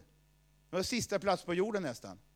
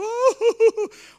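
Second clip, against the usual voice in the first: a high, loud burst of helpless laughter; high belly laugh; 15 dB louder than usual. Retold or imitated. Kjell on the church floor, laughing helplessly and loudly. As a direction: reenact the uncontrollable holy laughter. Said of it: "skrattar så jag har ont i magen".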